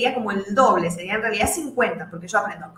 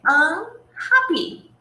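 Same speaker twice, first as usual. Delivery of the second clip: unhappy, drawn out slowly and a bit louder; teacher presenting unhappy slowly; slow clear model word; 5 semitones higher, 3 dB louder, much slower than usual. Shown as a demonstration. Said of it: "vamos a la tercera".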